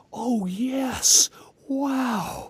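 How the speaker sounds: high, airy wonderment; unfitting protagonist voice. Retold or imitated. Host mimics amnesiac hero among zombies, high wonder. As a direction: mimic a blissfully amazed amnesiac. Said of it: "he's going around like".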